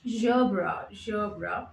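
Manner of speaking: "gerbera" as two syllables, ZHOH-bra; demonstrated pronunciation. Shown as a demonstration. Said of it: "but it's pronounced as".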